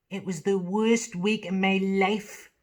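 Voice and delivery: a dramatic Geordie confession, drawn out. Retold or imitated. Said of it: "in the words of [name]".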